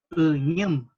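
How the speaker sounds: "uñem" begun with an unrounded ü, lips spread. Shown as a demonstration. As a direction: open "uñem" with the throaty ü. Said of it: "poner la boca como si dijeran una I".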